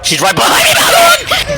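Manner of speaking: panicked high shout; screaming for help while chased; panicking gamer. Real, not acted. Chased in horror game.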